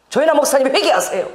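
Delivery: half-mocking rebuke; mock angry pastor. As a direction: imitate a pastor scolding her. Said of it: "막 저에게 화를 내시는 거야".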